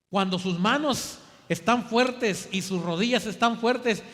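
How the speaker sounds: fuerte, exhortando; predicación enfática. Real, not acted. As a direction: empuja a la congregación con la voz. (exhortación fuerte)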